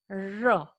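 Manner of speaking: R held as "rruh", tongue curled backward; retroflex R demo. Shown as a demonstration. Teacher shows tongue shape while pronouncing, drawn-out retroflex R. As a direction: give a lengthened, isolated R, tongue curled back. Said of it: "or curled backward".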